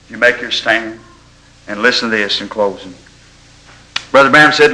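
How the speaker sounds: normal talking voice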